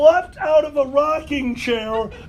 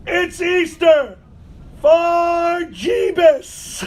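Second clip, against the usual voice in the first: slow, higher-pitched imitated yell; drunken toast yell; 5 semitones higher, slower than usual. Storyteller imitates drunk buddy toasting at midnight, yelling. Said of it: "My buddy yells out".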